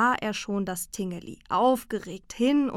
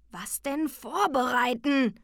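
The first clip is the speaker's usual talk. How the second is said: slow, higher-pitched bear whine; whining; 5 semitones higher, slower than usual. A narrator's reading. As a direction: moan it out reluctantly. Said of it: "jammerte der Bärbeiß". (gedehntes Jammern)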